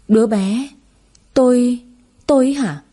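rising, stunned question; dazed disbelief. A narrator's reading. Woman in hospital asks the doctor back, stunned and dazed. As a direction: ask it back as if it can't be true. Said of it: "Tôi ngây ngốc hỏi lại".

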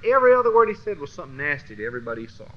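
rattled off fast; quick tumbling list of insults; fast run-on. Real, not acted. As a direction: rush through the line fast. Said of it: "repeats the phrase, second take fast".

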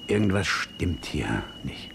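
slow, hushed whisper. Acted. Man confides his suspicion to the reporter, whispered slowly.

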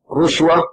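رشوة with the first vowel a short "u"; damma on the ra. Shown as a demonstration. Teacher shows students a valid variant, رشوة said "rushwa". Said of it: "وضمها".

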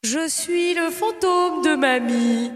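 very slow ghostly grandma. Acted. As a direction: stretch each word, eerie. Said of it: "la voix de fantôme".

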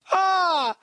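high, loud yell of fright; startled high scream; man's scream. A narrator's reading. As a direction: scream out at a sudden scare. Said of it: "заорал мужской голос".

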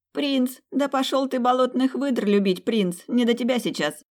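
sass him in a squeaky cartoon voice; Masyanya voice. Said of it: "голосом любимой мной Масяни".

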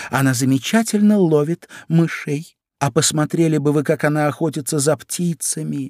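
high, gushing boast; proud Alice. A narrator's reading. Alice praises her cat's hunting, proudly. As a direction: brag in a high girlish voice. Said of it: "с гордостью ответила Алиса".

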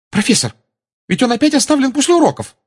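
high, loud, rushed complaint; indignant protest. A narrator's reading. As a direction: blurt the complaint to the professor. Pupil protests to the professor. (возмущённая, высокая, быстрая жалоба)

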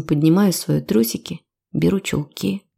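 ordinary delivery

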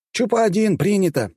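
high-pitched radio acknowledgement; pilot's radio reply. A narrator's reading. Chupacaber answers a pilot's report, high crisp radio call. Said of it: "ответил Чупакабер".

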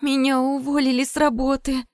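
a touch of tearful wailing, pitched high; half-crying complaint; mild wail. A narrator's reading. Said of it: "заревела Настя в трубку".

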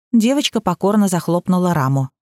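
ordinary delivery, nothing marked

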